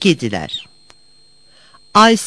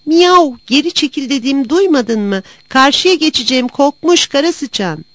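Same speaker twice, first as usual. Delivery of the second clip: high-pitched, scolding cat; angry cat shout; 7 semitones higher, 4 dB louder than usual. A narrator's reading. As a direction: yell at the rival to back off. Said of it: "öfkeli öfkeli bir daha bağırdı".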